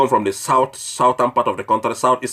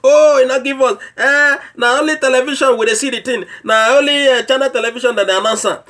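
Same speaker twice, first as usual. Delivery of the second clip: mock complaint; 9 semitones higher than usual. Mocking the public's complaint about relief, in a high whine. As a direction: mimic the people moaning about no relief. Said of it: "You dey cry say Buhari no give you palliative relief".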